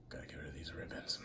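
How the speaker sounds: muttered whisper after signing off; whispered aside. Real, not acted. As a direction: whisper to yourself.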